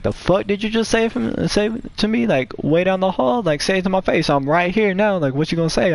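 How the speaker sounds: high-pitched, heated challenge without shouting; acted indignant dare, pitch jumping way up; confrontational. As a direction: re-enact squaring up to someone. Retold face-to-face confrontation before a fight.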